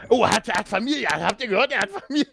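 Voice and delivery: high, rising gasp of mock shock; hammed-up amazed whisper-to-crowd excitement; mock astonished gossip. Crowd gossips about Batman's family.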